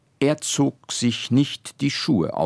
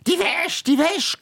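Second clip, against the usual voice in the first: much higher nagging screech; shrewish scolding. Acted. Old Guschke nagging again about the laundry, high screech. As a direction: nag like a shrill old shrew. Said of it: "keifte die alte Guschke von neuem".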